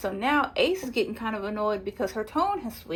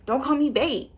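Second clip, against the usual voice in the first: a little louder and sharper, mildly mocking; curt, hostile twist on the line; snappy retelling; 3 semitones higher, 3 dB louder than usual. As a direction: bite off the words as if offended. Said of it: "Amaya switches the tone".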